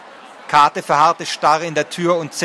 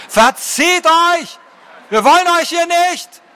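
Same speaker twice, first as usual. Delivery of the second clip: much higher, louder, slow shout; the villagers join in, driving off the dragons with a far higher, louder yell; full shout; 12 semitones higher, 4 dB louder than usual. A narrator's reading. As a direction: bellow it as the crowd joins in.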